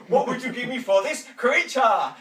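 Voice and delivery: loud, high auctioneer's cry over the crowd; booming auctioneer bid; auctioneer's call. Acted. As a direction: call the bid out big and bright. Auctioneer calling to audience.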